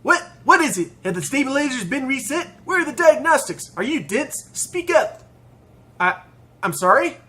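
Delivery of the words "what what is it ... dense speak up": high, impatient mechanic rattling off questions. Acted. Airship mechanic questioning supposed crew, impatient voice. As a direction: demand answers like an irritated technician. Plays gruff mechanic snapping at player.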